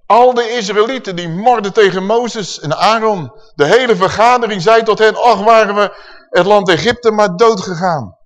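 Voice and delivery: loud, high preaching voice; forceful scripture reading. Acted. Preacher reads out Israel's grumbling against Moses, loud and high. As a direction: proclaim the people's complaint to the whole hall.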